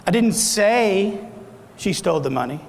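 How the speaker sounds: long, leaning "say" before a pause; stress on SAY. Shown as a demonstration. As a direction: hold the word "say", then let "she stole the money" fall away. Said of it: "say that several different ways".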